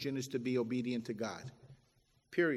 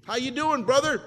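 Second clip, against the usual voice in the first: higher, louder hammed-up church greeting; mock cheerful greeting; 7 semitones higher, 9 dB louder than usual. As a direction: play a chirpy brother in the pew.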